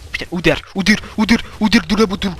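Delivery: loud, high repeated alarm; excited yelling. Real, not acted. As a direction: shout the name in panic. Enemy Udyr ganking in fight.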